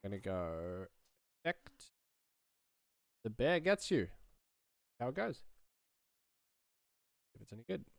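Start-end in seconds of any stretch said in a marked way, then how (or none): none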